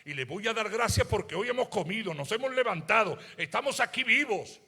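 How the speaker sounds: loud, high preaching voice, stretched out; fiery preaching. Acted. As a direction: preach it full out to the congregation. Preacher proclaims gratitude.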